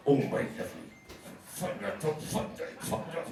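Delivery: lightly voiced old queen, hushed swearing; a hint of a gruff old friend saying hello; old drag queen greeting. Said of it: "which is hello".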